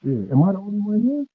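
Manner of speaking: muffled. Real, not acted. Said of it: "you sound muffled".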